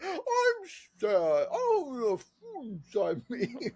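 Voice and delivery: high, drawn-out mock wail; sad cartoon fridge.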